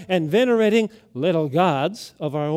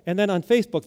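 usual speaking voice

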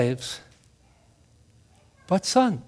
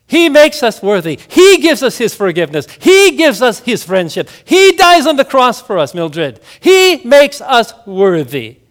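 louder, rising preaching; fervent preaching; 4 semitones higher, 6 dB louder than usual. Acted. Pastor telling Mildred the good news, fervently preaching. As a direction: proclaim it with conviction.

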